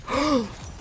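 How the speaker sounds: high mock gasp of delight; sharp, excited intake of breath; amazed gasp. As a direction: gasp like he has just woken up to it. Store guy perks up, amazed.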